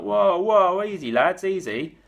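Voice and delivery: mock-panicked "easy, lads"; alarmed calming. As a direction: soothe a rowdy crowd in alarm. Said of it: "everyone's like".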